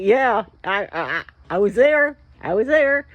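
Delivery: loud, rising I was there; excited insistence. Retold or imitated.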